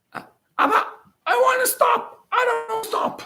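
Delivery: put-on high toddler whining to his dad; little kid voice. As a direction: whine it like a toddler mid-tantrum. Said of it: "the kid looks at him and says".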